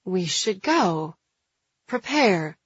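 slow, halting suggestion; hesitant. A narrator's reading. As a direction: feel your way into it, unsure. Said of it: "Jules says hesitantly".

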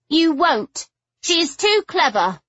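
high, emphatic insisting; insistent. Acted. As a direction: insist hard, won't be moved. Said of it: "she insisted".

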